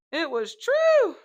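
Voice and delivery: high, loud, slow "It was true."; dramatic declaration. Shown as a demonstration. Speaker proclaiming to viewers, high and theatrical. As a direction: proclaim it like a grand reveal.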